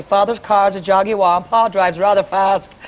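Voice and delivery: a hint of prim posh English diction, slow on the last line; elocution-lesson posh. Shown as a demonstration. Singer reciting her old elocution drill in mild posh. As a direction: recite it like a proper elocution exercise. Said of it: "sent me to speak properly".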